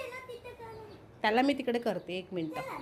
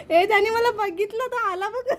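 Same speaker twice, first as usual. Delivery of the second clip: excited squeal; 8 semitones higher, 4 dB louder than usual. Real, not acted. Caught while hiding in game.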